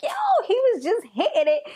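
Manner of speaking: high, put-on disbelieving voice; sassy high mock reaction; mock incredulous. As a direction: squeak it out in mock shock. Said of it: "I'm like".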